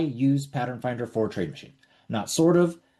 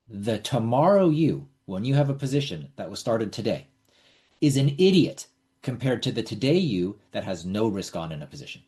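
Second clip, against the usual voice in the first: usual speaking voice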